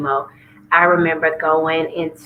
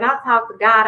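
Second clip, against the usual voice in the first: usual speaking voice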